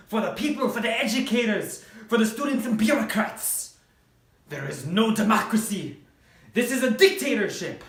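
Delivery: rally them in a panic; urgent shouting. Acted. Journalists urgent alarm.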